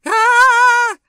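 very loud, high shriek; piercing, sustained "Ah!"; terrified scream. A narrator's reading. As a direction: scream in sheer terror. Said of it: "screamed Sophie".

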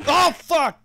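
high, loud shocked cry; startled yell. Real, not acted. Gamer cries out when surprised in game.

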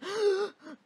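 high mock sobbing gasp; hammed-up sobbing intake; imitated crying. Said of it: "chorando, assim".

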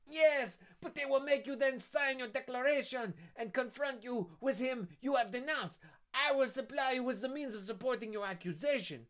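high, loud sly voice; scheming Danglars. Acted. Danglars proposing to denounce Dantes, high sly voice. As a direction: savour the plot to denounce.

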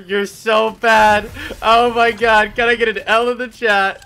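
loud, slow gloating taunt. Real, not acted. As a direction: rub it in loudly. Taunting killed sniper.

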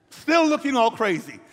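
ordinary delivery

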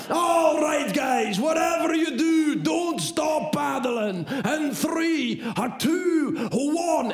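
rally the paddlers before launch; hammed-up instructor shout; shouted countdown. Guide starting the countdown.

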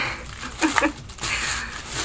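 soft voiceless giggle; breathy laugh. Real, not acted. Amused by the twin thing.

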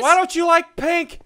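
high, loud fake protest; mock upset. Host complaining to viewers as a joke, loud mock upset. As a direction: wail it in pretend hurt. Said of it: "I'm kidding".

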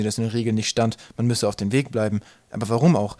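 plain reading voice

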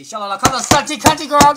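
loud, playful call to a friend; teasing shout. Real, not acted. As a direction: holler her nickname across the room. Calling out a friend's nickname.

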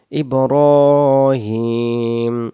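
ইব্রাহিম stretched syllable by syllable; very slow ibrohim. Shown as a demonstration. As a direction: drag ইব্রাহিম out. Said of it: "র অক্ষর এক আলিফ". (ইব্রাহিম খুব টেনে)

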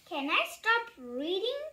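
ordinary delivery

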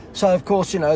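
normal talking voice

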